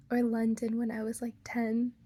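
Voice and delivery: normal talking voice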